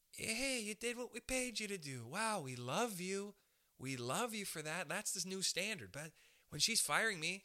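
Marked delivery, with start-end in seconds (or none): 0.1-4.9 mock gushing praise, higher; hammed-up overpraising manager; gushing boss. Comic imitating modern bosses praising basic work, mock gushing. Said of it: "good job is, you know".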